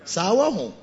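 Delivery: usual speaking voice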